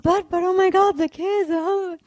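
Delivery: high, loud, choked-up protest; reliving a tearful plea to her teacher; retold crying plea. A woman tells her guru, near tears, of the kids. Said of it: "And I'm crying, crying, crying".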